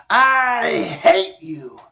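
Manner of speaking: loud yelled cry, drawn out slowly; boy screaming his anger into the cave; angry yell. Acted. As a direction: bellow it out in fury. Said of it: "he yelled out at the top of his lungs".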